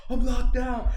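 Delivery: high, loud cry of alarm; reenacted frantic shout; panicked Riley. Driver realizing the car door won't open, loud panicked cry.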